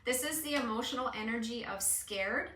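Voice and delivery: ordinary talk, nothing marked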